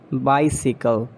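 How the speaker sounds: "Bicycle" as BY-si-kul; sickle ending. Shown as a demonstration. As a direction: rhyme the end of "Bicycle" with sickle. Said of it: "It's a sickle".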